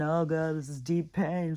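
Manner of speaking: hammed-up wail of suffering; mock howling anguish. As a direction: lightly imitate melodramatic howling. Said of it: "They're all down here howling and crying".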